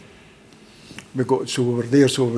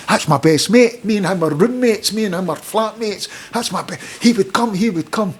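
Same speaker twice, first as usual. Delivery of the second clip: put-on raised, excited young player's voice; eager young player; 7 semitones higher than usual. Young Irish player tells manager his best mate should come, raised and eager. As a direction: pitch it up high like a keen youngster vouching for his mate.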